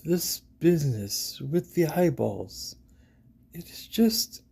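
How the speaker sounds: slow, hesitant, halting for words; stammering. A narrator's reading. Player's character groping to explain to Siba, stammering. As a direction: falter and trail into breath. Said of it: "you stammer".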